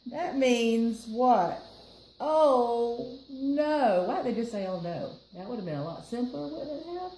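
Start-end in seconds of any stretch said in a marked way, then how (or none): none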